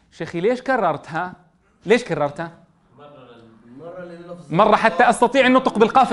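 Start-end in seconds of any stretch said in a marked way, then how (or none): none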